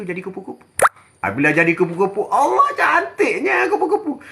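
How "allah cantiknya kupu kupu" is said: mock delight. Delight at butterfly.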